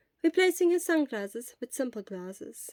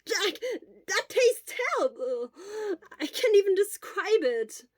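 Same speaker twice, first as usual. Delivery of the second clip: higher, mock-pained whine; spitting out the bitter taste; Hisashi disgusted; 9 semitones higher than usual. A narrator's reading. Hisashi complaining about the medicine, higher, hammed-up disgust.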